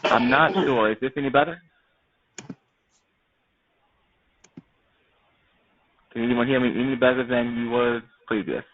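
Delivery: muffled, mouth too close to the mic. Real, not acted. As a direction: speak muffled, lips on the headset. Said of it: "It's kinda muffled".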